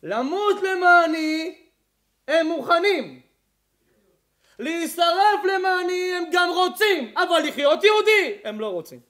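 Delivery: preaching God's words at full force; God's voice, preached. Acted. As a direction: shout it as the voice of God rebuking. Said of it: "זה מה שאומר השם".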